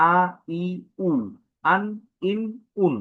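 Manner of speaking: আ ই উ আন ইন উন said short and distinct; crisp recitation of আ ই উ আন ইন উন; hamza vowel drill. Shown as a demonstration. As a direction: model each sound for the class. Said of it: "বিভিন্ন উচ্চারণ হচ্ছে".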